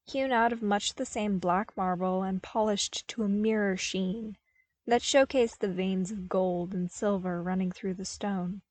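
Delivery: ordinary delivery, nothing marked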